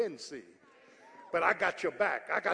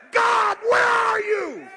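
loud, high pleading wail, slowed right down; anguished cry to God; 14 dB louder, much slower than usual. Retold or imitated. Preacher voicing his cry to God.

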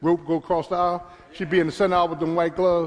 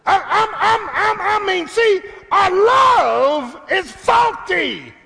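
high, loud, slow preaching cry; preaching shout; 11 semitones higher, 6 dB louder, slower than usual. Real, not acted. Preacher to the congregation, shouting slowly. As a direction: lift it and hold every word.

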